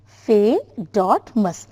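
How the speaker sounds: "Fe dot mus" slowly, a clear pause at each break; broken into syllables. Shown as a demonstration. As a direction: say "Fe", "dot", "mus" as separate slow pieces. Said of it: "I have put a dot here".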